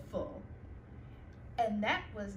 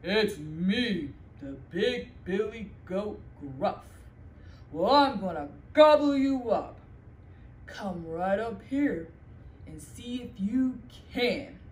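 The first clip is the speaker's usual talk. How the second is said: loud, lower big goat challenge; big billy goat; 4 semitones lower, 7 dB louder than usual. Acted. Big goat daring the troll to come up, loud. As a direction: dare him like a big bold goat.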